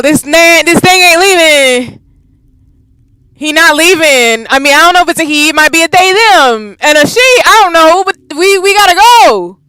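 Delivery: loud, high, frantic rant; panicked. Real, not acted. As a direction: scream that the monster is staying. Panicked rant about the monster.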